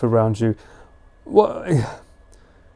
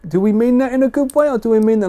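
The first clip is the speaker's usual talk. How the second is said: teasing rise; 9 semitones higher, faster than usual. Real, not acted. Teasing question to chat.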